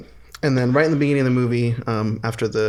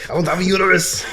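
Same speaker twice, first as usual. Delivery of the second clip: higher, louder, protesting; mock indignant Arnie; 7 semitones higher, 5 dB louder than usual. Arnie protesting to DeVito, high and indignant. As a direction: whine the objection in disbelief.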